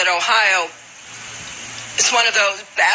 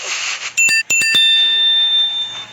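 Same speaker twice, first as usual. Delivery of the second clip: chesty cough. Real, not acted. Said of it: "once I get over my allergic reaction".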